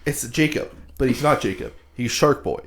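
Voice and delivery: ordinary talk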